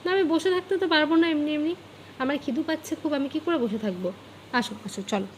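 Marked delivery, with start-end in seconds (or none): 4.6-5.3 soft coaxing. Real, not acted. Mother coaxes her sulking child up, soft cooing. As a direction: coax gently, almost crooning.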